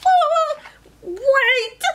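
very high, squeaky-pitched call; squirrel crying out. Acted. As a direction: cry out high for someone to wait. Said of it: "cried Squirrel".

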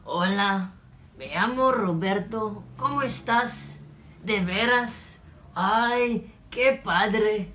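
slow, worn-out Mexican Spanish drawl; tired Mexican Spanish accent. Shown as a demonstration. Showing viewers how a weary Mexican says hello, slow Mexican accent. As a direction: sound exhausted, like you just carried a box, in a Mexican accent. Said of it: "Magiging ganito yan".

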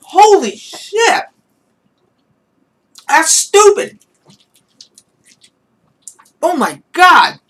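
high, loud yelps at sour taste; eating sour candy. Real, not acted. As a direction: shout through the sour shock.

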